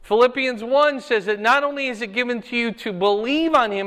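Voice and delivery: ordinary delivery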